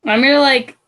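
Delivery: ordinary talk, nothing marked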